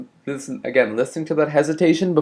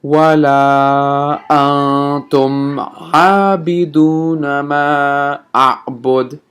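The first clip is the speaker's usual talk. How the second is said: very slow, drawn-out chanted recitation; teacher repeats verse five for students; 4 dB louder, much slower than usual. Shown as a demonstration. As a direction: recite verse five slowly once more. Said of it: "repeat verse five again".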